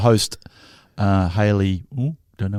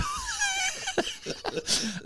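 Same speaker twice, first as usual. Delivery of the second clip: high squeaky laugh; 11 dB quieter than usual. Real, not acted. Laugh after girl voice.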